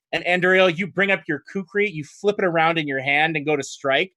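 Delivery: ordinary delivery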